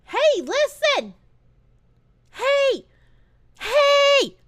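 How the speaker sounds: high, slow fairy calls; hey listen fairy voice; Navi impression. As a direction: call out like a nagging fairy. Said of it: "I can be like".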